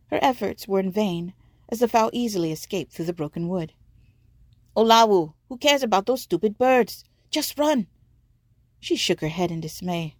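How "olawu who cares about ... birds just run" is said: loud, higher, pleading yell to run; urgent shout. Acted. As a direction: shout at her to run now. Batiko urging her to flee.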